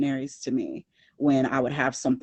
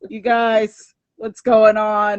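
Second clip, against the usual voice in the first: groaning, loud, higher greeting; mock fed-up hello across the street; exasperated greeting; 8 semitones higher, 7 dB louder, slower than usual. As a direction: call out to them with a weary ugh. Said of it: "I was like".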